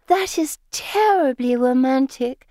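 high, loud romantic gush; swooning. A narrator's reading. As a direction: sigh it out, dreamily overcome.

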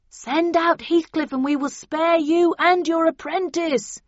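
loud, slow megaphone yell; bullhorn yell. A narrator's reading. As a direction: bellow it through a bullhorn. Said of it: "yelled the bullhorn again".

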